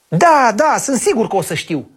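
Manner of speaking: put-on eager child. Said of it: "zise băiatul cu nerăbdare".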